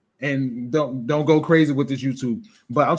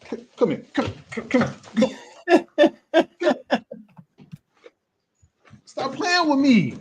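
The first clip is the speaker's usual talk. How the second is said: calling someone over; 10 semitones higher than usual. Real, not acted. Calling a pet or kid over.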